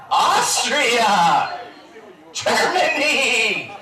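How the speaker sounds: high, drawn-out crowd call. Real, not acted. Host greeting countries in the audience, calling out. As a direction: call it out to the hall, laughing.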